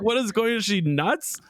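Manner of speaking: mock disbelief. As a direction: ask it like you can't believe your eyes. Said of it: "I was like".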